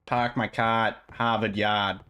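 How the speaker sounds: slow, drawn-out Boston accent. Shown as a demonstration. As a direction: stretch the vowels, Boston style, no R. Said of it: "The Boston thing".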